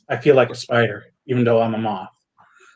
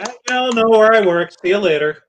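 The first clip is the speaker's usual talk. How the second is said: loud, higher cocky sign-off; cocky quip; 9 semitones higher, 4 dB louder than usual. Acted. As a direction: toss it off with swagger. Character quips at comedy club.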